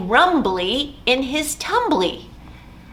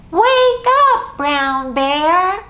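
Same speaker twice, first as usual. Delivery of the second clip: a loud high call, much higher on wake; 8 semitones higher, 6 dB louder, slower than usual. Shown as a demonstration. Librarian calls to wake Brown Bear with the kids. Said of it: "help me try to wake him up by calling out".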